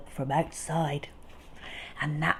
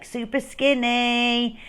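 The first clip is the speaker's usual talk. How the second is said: taunting; 5 semitones higher, slower than usual. Acted. Kids taunting.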